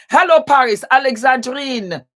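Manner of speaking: high, loud, delighted call-out; excited greeting. Real, not acted. Greeting a Paris viewer on the live stream, loud and delighted. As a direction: sing out hello to the city.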